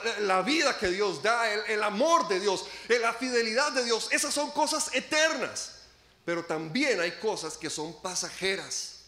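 usual speaking voice

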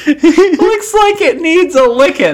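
high, slow, cracking-up repeat; laughing repeat. Retold or imitated. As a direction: repeat it while you can't stop laughing. Repeating the funny quote.

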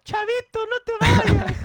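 high, slow mock plea to the kid; hammed-up sitcom farewell, high and drawn out; pleading Chavo scene. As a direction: stretch out a high begging no te vayas. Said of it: "me imaginé al Chavo del Ocho cuando lo corren".

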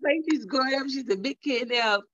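choked-up, proud mother; fighting back tears. Real, not acted. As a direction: talk through welling tears. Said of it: "I'm trying not to cry myself".

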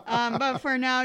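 loud burst of laughter; laughing. Real, not acted. Big laugh mid-explanation.

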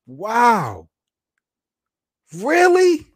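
high, loud disbelieving "Really?"; stunned by the news, voice jumping up; shocked. Real, not acted. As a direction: pitch leaps high in disbelief.

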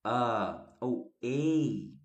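"a ou ei" drawn out very slowly; two pronunciations. Shown as a demonstration. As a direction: stretch "a" and "ei" slowly for the class. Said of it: "que a gente pode dizer".

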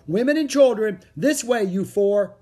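high, loud directing call. A narrator's reading. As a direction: call people over to the boat. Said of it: "a man called".

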